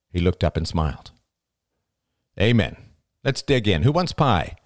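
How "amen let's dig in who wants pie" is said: louder, higher, rising cheer; hearty invitation. A narrator's reading. Oliver's father closing grace and offering pie, hearty. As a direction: call everyone to eat.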